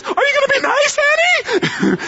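very high, shaky coaxing; terrified pleading. Retold or imitated. Terrified woman coaxing the attacking dog, very high. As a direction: coax a scary dog in sheer terror. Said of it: "then she says".

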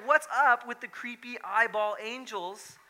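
high, loud mock bafflement; incredulous question. Preacher voicing listeners puzzled by eyeball angels, loudly incredulous. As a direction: demand an explanation, playfully freaked out.

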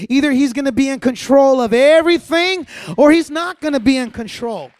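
loud, rising preacher's climb that peaks in a near shout; full-force preaching. Acted. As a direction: drive it home to the whole church. Building to a preaching peak.

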